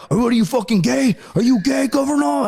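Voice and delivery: high mock interrogation yell; drill instructor shouting. Drill instructors questioning the recruit, high mock shout.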